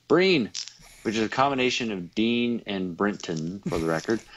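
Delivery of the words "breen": "Breen" pitched high and loud, then falling; exaggerated loud "Breen". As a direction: sing out "Breen" playfully. Repeats nickname in contrasting ways.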